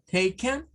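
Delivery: taken with a weak schwa second vowel, TAY-kn; English taken. Shown as a demonstration. Teacher demonstrating taken to students. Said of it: "lo que vendría siendo taken".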